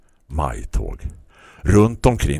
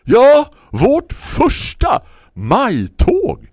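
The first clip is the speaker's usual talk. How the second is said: much higher, louder, very slow shout; hollering; 6 dB louder, much slower than usual. Acted. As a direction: holler it out proudly. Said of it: "hojtade grannen".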